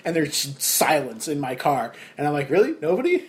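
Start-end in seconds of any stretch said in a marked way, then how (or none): 2.5-3.2 high, incredulous "Really? Nobody?"; mock disbelief. Driver teasing his sleeping friends, mock disbelief. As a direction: pretend hurt that nobody laughs.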